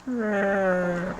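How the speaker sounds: high put-on voice; practiced character voice. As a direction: try out a silly character voice. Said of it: "Sorry, I'm practicing my voices".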